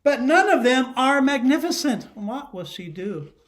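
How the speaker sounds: ordinary talk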